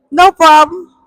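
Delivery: high, loud breezy bluff; fake confident. Teen bluffing to mom, high cheery fake confidence. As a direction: act totally unbothered, high and bright.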